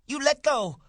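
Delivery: piercingly high, loud demand; crying out. A narrator's reading. Wife struggling to free her hair, crying out. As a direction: cry out to be released, voice leaping up. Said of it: "she cried".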